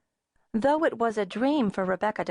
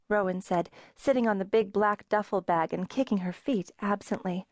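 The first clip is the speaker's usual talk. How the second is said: usual speaking voice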